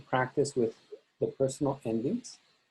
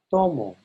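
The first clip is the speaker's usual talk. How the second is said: TO of "Tomo" louder, falling away on mo; stress on first syllable; 4 dB louder than usual. Shown as a demonstration. Teacher models "Tomo" for students, first syllable stressed. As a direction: hit TO in "Tomo", let mo drop. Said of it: "let me pronounce these for you".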